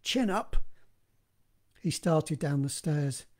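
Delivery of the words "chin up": higher-pitched, lightly mock English; best English accent. A narrator's reading. Man bucking himself up on the stairs, higher mock-English accent. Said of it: "muttered in his best English accent".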